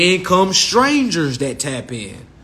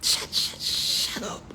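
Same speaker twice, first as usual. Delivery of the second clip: soft whispered shut up, laughing; whispered dismissal; 5 semitones lower, 10 dB quieter, slower than usual. Retold or imitated. Comedian hushing the late praisers, soft whispered shut up. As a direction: hush them with a breathy laugh. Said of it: "I'm like".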